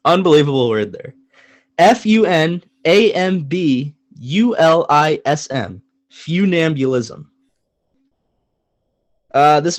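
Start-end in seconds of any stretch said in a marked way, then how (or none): 1.8-5.8 slow letter-by-letter spelling. Shown as a demonstration. Host spelling the word of the day for listeners, slow and deliberate. 6.1-7.2 "Funambulism" slowly as fyoo-NAM-byuh-lism; real pronunciation. Shown as a demonstration. Host restating the spelled word, slow "Funambulism" as fyoo-NAM-byuh-lism. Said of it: "here's how it's really pronounced".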